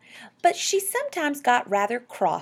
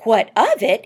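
loud, falling retort; incredulous echo; 4 semitones higher, 11 dB louder than usual. A narrator's reading. Husband repeating wife's question at the radio, incredulous. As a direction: throw the question back emphatically. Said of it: "he repeated".

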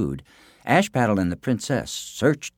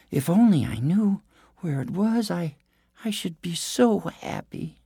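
high sweet storybook princess; cartoon princess; 8 semitones higher than usual. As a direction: coax it like a sugary princess. Princess pleads, high far voice.

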